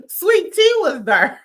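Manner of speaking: loud laugh through the words. Real, not acted. Laughing while retelling the dressing room scene. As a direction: crack up while saying it. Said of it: "laughing at the story".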